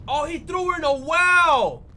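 loud, drawn-out disbelief; real shock, belting out each phrase; shocked exclamation. Real, not acted. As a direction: cry out in amazement. Shocked reaction to a rescue.

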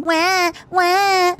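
high mock newborn wail; mock baby cry. Streamer mocks newly born soldiers, high pretend baby cry. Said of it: "Little baby Primaris just waking out of the womb".

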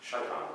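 "shaitan" with the stress on SHAI; Hebrew-style word. Shown as a demonstration. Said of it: "the word is shaitan".

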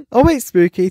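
high, loud sudden call; excited call-out. Real, not acted. Calling out to Spooky excitedly. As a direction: shout the name in surprise. Reacting to a chat message.

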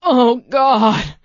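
high, loud cry of agony; anguished cry. A narrator's reading. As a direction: howl it in pain.

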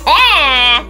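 short high excited squeal. Real, not acted. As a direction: squeal with delight.

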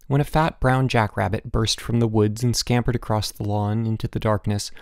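ordinary delivery

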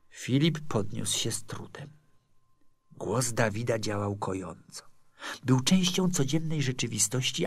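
usual speaking voice

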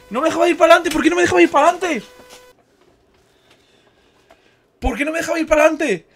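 high, insistent complaint; indignant protest. Real, not acted. Streamer asking why the game blocked them, indignant. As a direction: repeat it in aggrieved disbelief.

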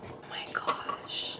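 slow unvoiced whisper; awed whisper. Real, not acted. Overwhelmed by the handmade doll, slow whisper. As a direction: let it slip out in awe.

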